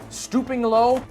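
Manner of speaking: usual speaking voice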